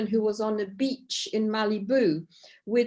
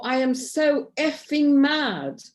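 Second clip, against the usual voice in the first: louder, higher, slow mock-mad outburst; acted anger; 6 semitones higher, 5 dB louder, slower than usual. Shown as a demonstration. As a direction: vent it big so they hear the anger. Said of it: "This is what you tap on".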